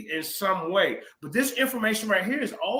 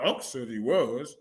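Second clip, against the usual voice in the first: mocking quote; 7 semitones lower, 3 dB quieter, slower than usual. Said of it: "you gonna go by".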